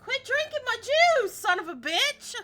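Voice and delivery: high, loud comic scolding; mock outrage. Player scolding someone over stolen juice, high mock-angry yell. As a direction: yell it high in playful indignation.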